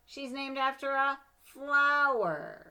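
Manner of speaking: high, loud, slow mock; scornful schoolgirl taunt; sneering. A narrator's reading. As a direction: drag it out to ridicule. Bratty kid mocking the name.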